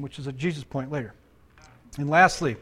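ordinary talk

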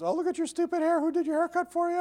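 put-on high, taunting kid voice; mocking schoolkids. Pastor imitating classmates teasing his haircut, high mocking taunt. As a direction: sneer like a schoolyard bully. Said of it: "teased at school".

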